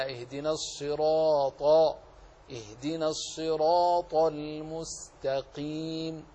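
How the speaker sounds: الصراط with thick emphatic sad and ta, slow; slow tajweed model of الصراط. Shown as a demonstration. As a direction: say الصراط with heavy, full tafkhim. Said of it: "فننطق هكذا".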